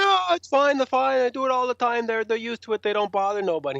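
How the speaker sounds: high mock reassurance, settling as it runs; breezy dismissive staffer. Staff reassure band about walking through crowd, high and dismissive. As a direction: imitate an official airily waving off concerns.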